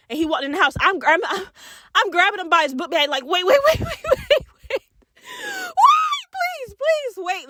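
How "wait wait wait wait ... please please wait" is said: high, frantic begging; panicked pleading. Retold or imitated. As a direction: squeal it in panic, then sink back down. Begging the cousin to stop.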